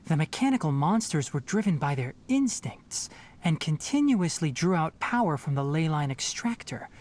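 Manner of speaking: ordinary talk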